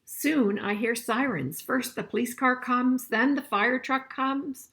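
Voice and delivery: storybook reading voice; story reading. A narrator's reading. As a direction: read it out clearly for the class. Reads the picture book aloud.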